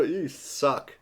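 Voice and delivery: ordinary delivery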